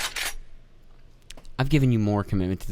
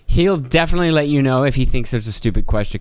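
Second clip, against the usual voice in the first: usual speaking voice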